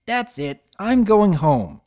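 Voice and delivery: slow, loud fed-up declaration; filly giving up on the dig; groaning quit. A narrator's reading. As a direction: drop it flat and storm off. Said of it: "the first one to groan".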